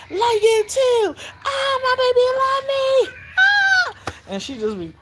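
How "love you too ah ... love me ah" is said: very high, loud squeal of love; giddy grandmother, shrieking with joy; gushing delight. Retold or imitated. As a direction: squeal as if melting over your baby. Her gushing reply, retold.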